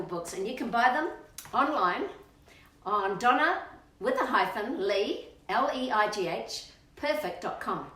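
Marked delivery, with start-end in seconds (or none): none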